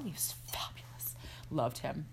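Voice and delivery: a soft, fond whisper; whispered aside. Real, not acted. Praising the hotel worker, fond whispered aside.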